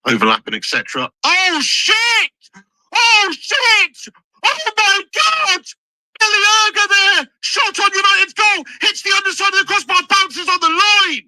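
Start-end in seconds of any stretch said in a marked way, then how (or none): 1.2-11.2 real alarm at a shot on their own goal; panicked shout. Real, not acted. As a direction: shout in panic as it hits the bar.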